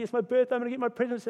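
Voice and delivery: high, eager child's voice, mildly imitated; excited kid. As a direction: bounce with birthday-eve excitement. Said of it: "they're so excited".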